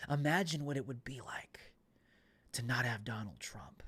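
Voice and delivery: sly whispered insinuation; insinuating whisper. Retold or imitated. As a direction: hush it like a dark suggestion.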